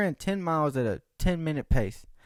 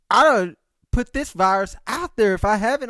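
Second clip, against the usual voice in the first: ordinary talk, nothing marked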